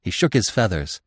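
ordinary delivery

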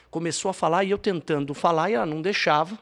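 normal talking voice

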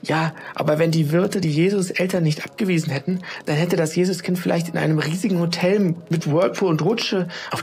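put-on high, earnest boy reasoning; a boy eagerly making his point; lightly voiced child, earnest. Tim voiced explaining to mother.

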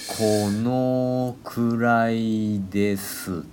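very slow, stretched-out speech; slow motion. Shown as a demonstration. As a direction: drag every syllable out slowly. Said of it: "それ三倍ぐらい遅くないかい？".

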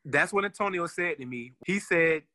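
ordinary talk

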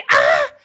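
high, loud mock scream; retold scream. Mocking a fan in the cinema, loud high shriek. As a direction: let out a sudden fan shriek.

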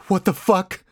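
high, panicked gasp of words; shaken exclamation. A narrator's reading. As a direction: breathe it out in alarm, pitched high. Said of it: "I managed to breathe out".

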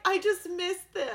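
high-pitched weepy quote; tearful retold grief. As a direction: quote her as if about to cry. Retelling grieving character, near tears.

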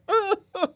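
high, loud mock crowd cackle; a hammed-up studio audience laugh; imitated crowd laugh. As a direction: play the braying audience.

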